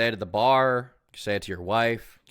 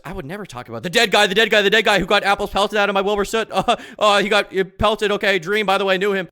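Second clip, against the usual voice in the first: higher, loud, hammed-up sanctimonious rant with a laugh; mocking impression; 10 semitones higher, 9 dB louder than usual. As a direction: rant it like a self-righteous hypocrite.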